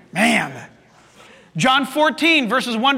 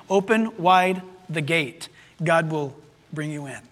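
ordinary talk, nothing marked

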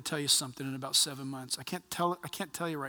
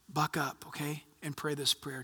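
ordinary talk, nothing marked

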